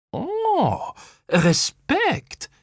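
high drawn "oh", then admiring; impressed grin. A narrator's reading. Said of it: "grinste Kaya". (anerkennend grinsend)

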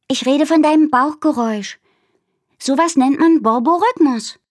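put-on a brainy little girl showing off facts; Minnie, know-it-all. Minnie lecturing Aru about her tummy, chirpy know-it-all.

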